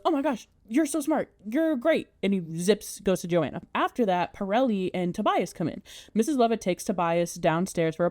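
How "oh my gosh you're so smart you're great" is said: high, excited mock gushing; starstruck gushing. Anthony gushing at Todd's plan, high excited praise. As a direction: rave like an awestruck fan. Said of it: "And Anthony goes".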